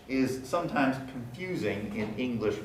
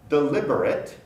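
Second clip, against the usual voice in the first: normal talking voice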